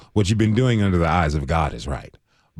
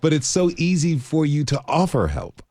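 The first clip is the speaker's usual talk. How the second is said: high, insistent interrupting question; emphatic question; 11 semitones higher than usual. Real, not acted. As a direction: stop her and press the point, voice up high. Interviewer stops and asks earnestly.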